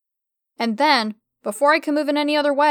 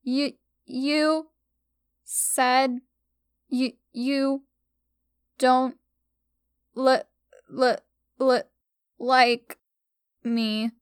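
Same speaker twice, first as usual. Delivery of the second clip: tearful stutter; much slower than usual. Acted. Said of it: "she stuttered out".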